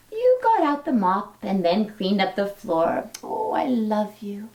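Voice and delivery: gentle, lilting storybook read-aloud; reading to children. Acted. Teacher reading a dinosaur story to her class, storybook lilt. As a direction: read it like a bedtime picture book. Reads a picture book to children.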